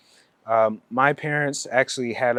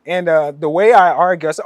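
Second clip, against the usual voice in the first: ordinary delivery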